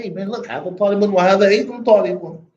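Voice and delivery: quick, offhand street voice; brisk casual introduction. Shown as a demonstration. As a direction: toss it off to a friend. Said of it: "I'm saying".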